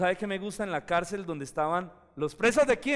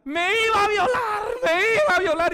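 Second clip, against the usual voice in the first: high, loud, crying false accusation; fake scream; 10 dB louder than usual. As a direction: wail it as a staged victim. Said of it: "ella empezó a gritar". (la esposa de Potifar grita a todos, llanto fingido y chillón)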